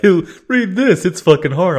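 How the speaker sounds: high, loud mock whine; mocking whiny reader. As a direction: sneer it like a griping reader. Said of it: "So when you're like".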